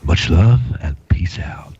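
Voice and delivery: slow whispered sign-off; whispered catchphrase. Pretend. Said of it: "Not bad".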